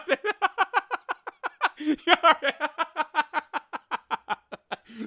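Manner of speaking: high, sustained laughter; long laughing fit. Real, not acted. Streamer cracking up at a comment, long laughing fit. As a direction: keep laughing helplessly, high-pitched.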